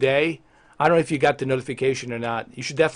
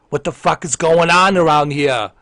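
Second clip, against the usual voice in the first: angry demand; 6 dB louder than usual. Acted. Disappointed streamer confronting Shadow, angry demand. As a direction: raise your voice in exasperation.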